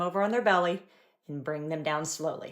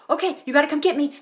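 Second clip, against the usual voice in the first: much higher, louder playful call; playful challenge; 9 semitones higher, 8 dB louder than usual. Retold or imitated. Mom inviting her toddler to chase her, playful call. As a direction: dare him to chase you, playfully. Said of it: "I just be like".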